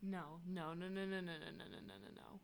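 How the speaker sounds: very soft, rapid string of refusals; low-volume disbelieving protest; hushed denial. Real, not acted. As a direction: murmur the refusals, fading to a whisper. Disputing that it's not a ten.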